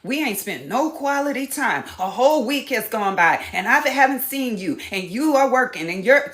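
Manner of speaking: mock accusing nag; accusing complaint. Shown as a demonstration. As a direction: pile on the blame. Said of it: "all that accusation and blame".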